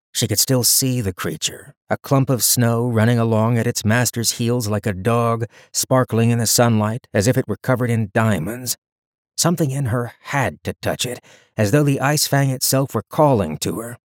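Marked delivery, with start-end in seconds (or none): none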